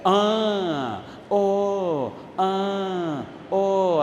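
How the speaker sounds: long drawn-out "ah... oh"; imitated oohs and aahs. Preacher mimics a hearer's reaction, drawn-out awed vowels. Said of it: "así dice".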